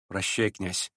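low, soft goodbye; quiet farewell. A narrator's reading. Настасья Филипповна parts from the prince, low and soft.

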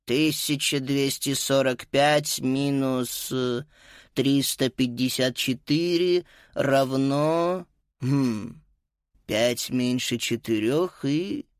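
slow and hesitant, pausing; slow, halting calculation. Acted. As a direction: work the sum out haltingly. Teacher stumbling over sum.

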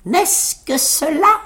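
high, slow, cocky disbelief; tailleur moqueur. A narrator's reading. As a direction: scoff at the giant in a high voice. Tailleur moqueur face au géant. (moquerie perchée et traînante)

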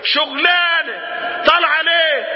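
high, drawn-out exasperated shout; exclaiming in rebuke. Acted. Preacher challenges a woman at a protest, exclaiming.

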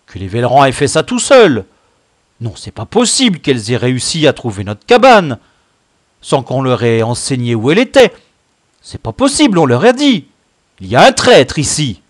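loud, indignant leader's tirade; roaring accusation. A narrator's reading. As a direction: roar your disbelief, building to an accusation. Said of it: "rugit-il". (rugir, accusateur et indigné)

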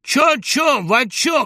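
very high, loud heckle; jeering shout. A narrator's reading. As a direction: shout it back in mockery. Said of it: "крикнул Панкратов". (издевательский выкрик)

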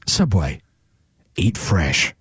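deep voiceover leaning on "fresh"; low tagline with "fresh" emphasised; stress on fresh. Shown as a demonstration. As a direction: put the weight on "fresh" in a low ad voice. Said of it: "more emphasis on the word fresh".

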